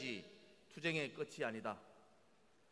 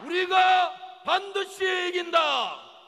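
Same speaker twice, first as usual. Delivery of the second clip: louder, higher, slow chanted slogan; roaring rallying speech; 16 dB louder, much slower than usual. Acted. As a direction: bellow the slogan for the crowd to echo. Leader calls chant, crowd echoes. (우렁찬 구호 선창)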